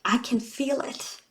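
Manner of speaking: soft, choked-up voice at the edge of tears; getting emotional. Real, not acted. Reader telling her Sagittarius viewers how painful divorce was, near tears. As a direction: let the feeling catch in the throat. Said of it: "I'm getting emotional talking about this".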